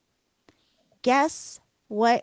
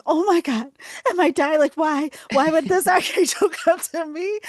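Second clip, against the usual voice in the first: high, loud, rushed panicky questions with a laugh breaking in; half-laughing reliving of the fear; mock-frantic retelling; retold panic; 7 semitones higher, 6 dB louder than usual. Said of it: "I started freaking out".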